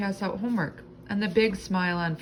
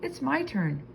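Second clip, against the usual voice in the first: eager exclamation; 8 semitones higher than usual. A narrator's reading. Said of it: "she shouted".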